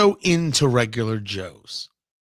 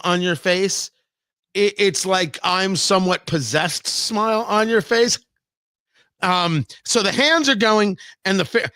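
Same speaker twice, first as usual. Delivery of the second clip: ordinary talk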